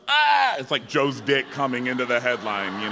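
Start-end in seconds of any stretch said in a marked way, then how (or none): 0.1-0.6 high, loud cut-in scream; sudden yelp. Acted. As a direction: burst in with a short loud yelp. Acts interruption of headline.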